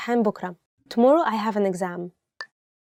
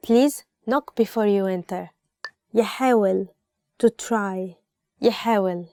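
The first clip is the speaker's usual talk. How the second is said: ordinary talk, nothing marked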